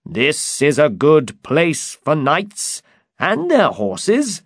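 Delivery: confident storybook knight voice; Don Quixote. Acted. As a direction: declare it grandly, unhurried and high.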